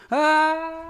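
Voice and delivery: sudden high yelp; startled yelp. Real, not acted. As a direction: yelp out in surprise. Startled cry in chat.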